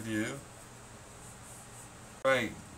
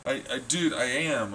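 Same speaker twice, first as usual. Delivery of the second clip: ordinary delivery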